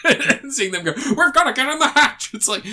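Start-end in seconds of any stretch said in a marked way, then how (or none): 0.9-2.3 high-pitched send-up of a film hero; mock urgent cry. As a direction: squeal it as a mock-panicked action line. Mocking film characters' urgent line.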